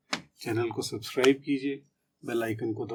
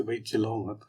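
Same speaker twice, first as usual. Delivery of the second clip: softer, gentle hush; soft request; 3 semitones lower, 8 dB quieter than usual. Shown as a demonstration. Asking someone gently not to shout, softly. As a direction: ask gently. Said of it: "अब एक से".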